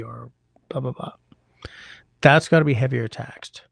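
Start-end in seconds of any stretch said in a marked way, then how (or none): 0.0-1.1 whispered trailing blah blah; softer throwaway aside; dismissive whisper. Real, not acted. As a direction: brush it off under your breath.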